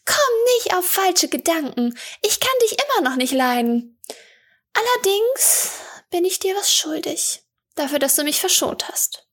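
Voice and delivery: put-on high young girl's voice, cool and prickly; a cheeky girl admitting she owes a debt; masked girl Eli, grudging. A narrator's reading. Masked girl to the water woman, high grudging girl voice.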